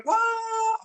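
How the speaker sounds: long high mock scream. Everyone on the plane in turbulence, mock screaming. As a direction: imitate a whole cabin screaming. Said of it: "semua teriak".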